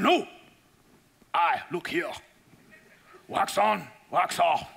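lightly put-on Miyagi accent, firm; a hint of a patient old master insisting; Miyagi impression. Teacher insisting to his student, light Miyagi accent. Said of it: "And he goes".

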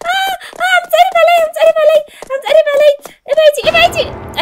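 high, loud terrified yelling; frightened shrieks, voice way up; panicked screaming. Real, not acted. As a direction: scream at the monster to get away. Monster closing in on her.

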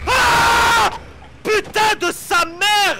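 frightened drawn-out curse; scream, then swear slowly; scared scream. Real, not acted. Jump scare in horror game.

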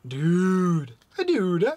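loud, put-on character dude. Shown as a demonstration. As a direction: voice it like a cartoon character. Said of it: "the way you think these characters would say it".

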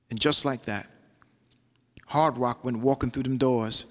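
measured rhythmic poetry delivery; spoken word poem. Acted. Poet recounting taking the year in prison, spoken word. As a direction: perform it like a slam poem, riding the rhythm.

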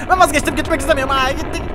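frantic repeat of the plea. Pretend. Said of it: "durdurabiliyorsun bunu".

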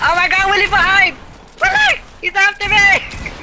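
high, loud alarmed calls; panicked shout. Real, not acted. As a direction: scream the warning for help. Teammate under attack calls warning.

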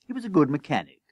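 usual speaking voice

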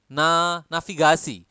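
navigasi said as nafigasi. Shown as a demonstration. Teacher modelling navigasi, V said as F. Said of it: "we pronounce the V as F".

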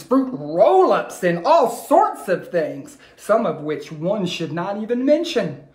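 put-on rhythmic read-aloud for kids; lilting rhyming storyteller voice; story narration. A narrator's reading. Teacher reading a rhyming story to her class, lilting.

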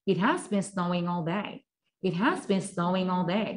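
천천히 한 단어씩 따라 하게 읽기; 학생들이 따라 하도록 또렷하게; 또박또박 시범 낭독. Shown as a demonstration. Teacher models sentence. (따라 하기용 느린 시범 낭독)

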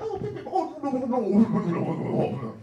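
high, hammed-up posh English "pip pip"; mock a fancy English gent protesting; posh English accent. Comic mocking how Americans hear English people, hammed-up posh English accent. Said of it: "'cause you're like".

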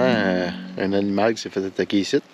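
ordinary talk

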